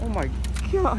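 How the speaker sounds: high, loud thrilled cry; amazed. Acted. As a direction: marvel at each new piece.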